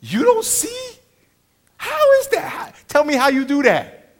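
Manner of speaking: high-pitched, loud disbelief; incredulous. Retold or imitated. Comic telling friends what went through his mind, incredulous. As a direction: demand an answer in baffled disbelief. Acting out his baffled inner thoughts.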